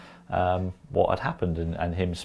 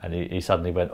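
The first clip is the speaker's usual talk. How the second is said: normal talking voice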